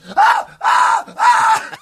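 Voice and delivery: a high, far-off yelp of agony; imitated scream of pain. Retelling the suspect bitten by a police dog, high yelp. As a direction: mimic the man screaming as the dog bites.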